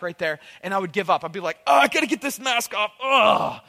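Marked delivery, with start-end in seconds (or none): 1.7-3.6 high, loud, straining outburst; panicked struggle. Retold or imitated. Kid stuck in rubber mask, loud strained panic. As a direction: act out fighting to pull it free.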